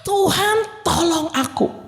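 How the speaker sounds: high pleading cry to the Lord; Peter crying out. Acted. Peter sinking in the sea, pleading high. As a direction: beg for help as if sinking. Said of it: "Yang pertama dia bilang adalah".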